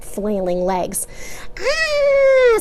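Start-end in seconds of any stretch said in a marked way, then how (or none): 1.6-2.6 put-on high, loud baby squeal. A narrator's reading. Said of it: "squealed William".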